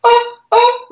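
mock hyperventilating gasps. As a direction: imitate mom too overcome to get words out. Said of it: "spasmy, can't talk, hyperventilating mode".

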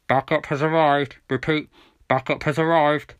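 loud radio dispatch through a speaker; police radio voice. A narrator's reading. Backup reporting to police car, radio voice. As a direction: announce it like a message over police radio. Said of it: "a voice came on to the police radio".

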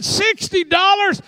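very high, slow, disbelieving; shocked question. Retold or imitated. Pastor hearing Vanessa ask for sixty dollars, shocked and high. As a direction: repeat the sum in disbelief.

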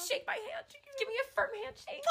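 tiny, high, soft squeak; near tears. Real, not acted. Overwhelmed friend asking for handshake, high tearful squeak. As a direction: squeeze it out small and tearful. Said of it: "I'm gonna cry".

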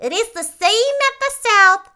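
high, loud little Munchkin voice; second Munchkin. A narrator's reading. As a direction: pipe up loudly as a tiny townsman. Narrator voices another Munchkin.

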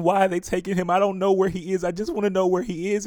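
high, loud, urgent plea; crying out. Acted. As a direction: cry it out in anguish. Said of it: "she was crying out and saying".

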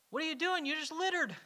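playing his younger self asking a grown-up, shocked; ten-year-old me. Telling a youth group how his childhood self questioned a litterer, high child voice. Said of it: "ten-year-old me is like".

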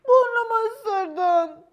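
high, slow, whiny fox voice starting to cry; tearful high wail about a bitten nose. Acted. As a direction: blubber it like a hurt little fox. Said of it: "diye ağlamaya başladı".

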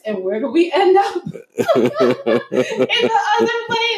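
high, loud, stretched out through laughter; real cracking-up delivery; laughing punchline. Real, not acted. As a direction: barely get it out for laughing.

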